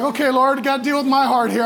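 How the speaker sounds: loud, high plea to God; exasperated plea. Retold or imitated. Harried dad pleading with God over his heart, exasperated. Retold desperate prayer mid-tantrum.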